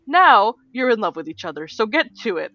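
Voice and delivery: hammed-up divine command; mock goddess scolding. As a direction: order them around like a smug goddess.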